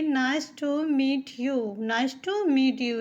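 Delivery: slow, careful teacher reading; drawn-out demo phrase; slow model sentence. Shown as a demonstration. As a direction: say it slowly so students follow. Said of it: "हम English में कहेंगे".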